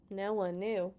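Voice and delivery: plain reading voice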